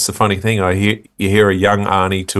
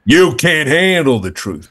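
Jack Nicholson mean voice; 5 semitones higher than usual. Retold or imitated. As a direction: spit it out slowly like a snarling tough guy. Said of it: "your mean voice".

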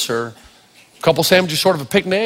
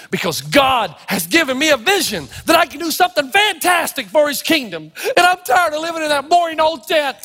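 excited, high-pitched proclamation; fervent declaration; 10 semitones higher than usual. Acted. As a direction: declare the vision with rising zeal, playing Abraham. Acts out Abraham's fervent answer.

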